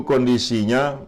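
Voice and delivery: ordinary talk, nothing marked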